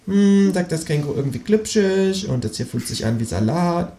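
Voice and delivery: high, musing animal voice; kangaroo voice. Acted. Kangaroo tries the narrator's food, high and musing. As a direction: taste and judge it.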